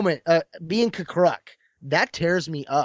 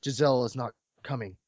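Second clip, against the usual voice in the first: much lower, soft and heavy; grieving reply; 6 semitones lower, 7 dB quieter than usual. Retold or imitated. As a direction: say it with quiet grief. Grieving Jedi answers the child.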